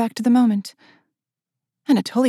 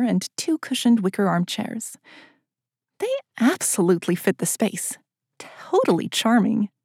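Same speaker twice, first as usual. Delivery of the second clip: ordinary delivery, nothing marked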